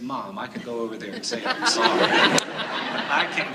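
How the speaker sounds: put-on laughing through a childish plea; laughing while pleading.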